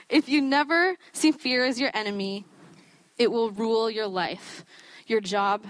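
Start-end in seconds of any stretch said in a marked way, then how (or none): none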